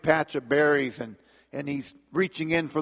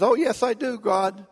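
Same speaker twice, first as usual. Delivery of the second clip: eager; 8 semitones higher than usual. Acted. Man eagerly assures God.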